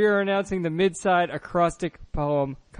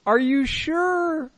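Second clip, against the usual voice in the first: teasing doubt; 8 semitones higher, slower than usual. Real, not acted.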